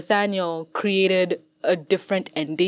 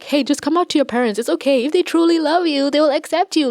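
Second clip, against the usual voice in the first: higher, louder sing-song mockery; sarcastic impression of feel-good stories; mocking cliché advice; 7 semitones higher, 4 dB louder, faster than usual. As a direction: parody a preachy, cheerful advice voice. Mocking cliché coming-out story advice.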